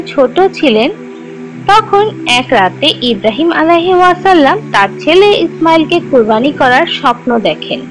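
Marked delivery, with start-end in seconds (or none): none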